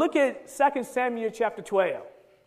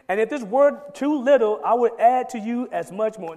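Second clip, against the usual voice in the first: full-force preaching; 3 semitones higher, 6 dB louder than usual. Acted. Preacher voicing God's message to David, booming proclamation. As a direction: thunder the word of the Lord.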